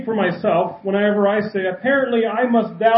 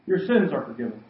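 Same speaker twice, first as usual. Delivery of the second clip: quiet Jesus reply; 7 semitones lower, 5 dB quieter, faster than usual. Retold or imitated. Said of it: "Jesus told the paralytic".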